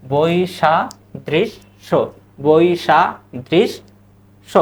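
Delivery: বৈসাদৃশ্য stretched very slowly as boi-sha-drish-sho; slow model pronunciation. Shown as a demonstration. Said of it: "তারপরে কি?".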